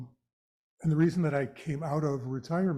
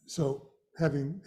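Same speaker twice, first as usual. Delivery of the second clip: ordinary talk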